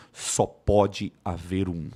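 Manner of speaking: slow, low and portentous; solemn warrior motto. Acted. Teacher quoting a warrior motto from a film, solemn. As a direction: intone it like an immortal warrior's creed. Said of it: "o lema desses guerreiros é".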